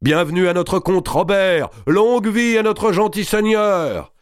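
very high, ringing acclamation; great clamour. A narrator's reading. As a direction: cheer your lord from the ranks. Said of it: "une immense clameur s'éleva dans leurs rangs". (immense clameur de bienvenue)

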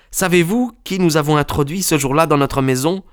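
put-on high, urgent young man's voice; hurried, high-pitched questioning; distressed young friend. A narrator's reading. Son urging his friend into the cart, high and urgent.

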